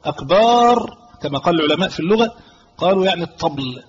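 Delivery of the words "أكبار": أكبار with a long "aa" after the b; elongated "akbaar" mispronunciation. Shown as a demonstration. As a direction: stretch the second syllable of أكبار. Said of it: "يقول كلمة ليست هي المراد".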